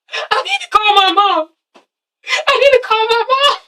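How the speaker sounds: high, wailing mock plea that climbs to a cry; high-pitched imitation of a sobbing woman; imitating distraught Amber. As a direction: ham up a panicked girl begging for her mom. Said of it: "She was like".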